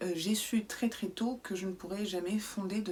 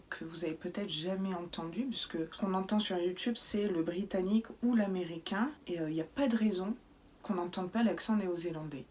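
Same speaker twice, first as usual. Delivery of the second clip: usual speaking voice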